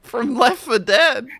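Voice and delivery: talking through big laughs; laughing. Real, not acted. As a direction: laugh while you say it. Laughing at the goblin voice.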